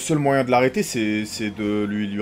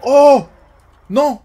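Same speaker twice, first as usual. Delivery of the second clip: surprised shout; 14 semitones higher, 14 dB louder than usual. Real, not acted. Car chase goes wrong.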